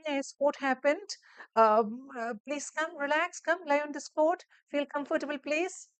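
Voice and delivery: high, slow, rising reassurance; caring nurse intonation. Shown as a demonstration. Teacher models nurse calming a patient, high and gentle. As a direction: coax the patient gently to relax. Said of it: "That intonation you need to focus on".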